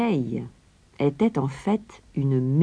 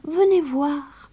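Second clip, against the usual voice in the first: put-on high, slow innocent girl; little Gretel; 11 semitones higher, slower than usual. A narrator's reading. Gretel luring the witch to the oven, high slow girl. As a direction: coax the witch closer. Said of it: "demanda Gretel".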